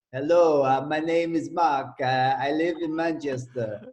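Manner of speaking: mock French accent on English words. Classmate bluffing through his French exam in English, hammed-up French accent. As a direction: put on a comic French accent. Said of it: "In a French accent".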